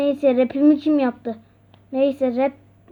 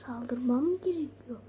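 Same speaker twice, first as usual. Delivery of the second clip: hushed unvoiced question; whispering; 9 dB quieter than usual. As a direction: whisper it, afraid of being heard. Hearing slime at night.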